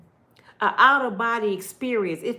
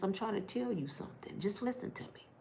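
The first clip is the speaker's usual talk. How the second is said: softer, dropping to a whisper; soft urgent hush; hushed plea; 12 dB quieter than usual. Shown as a demonstration. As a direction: lean in and murmur it close. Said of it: "drops to whisper".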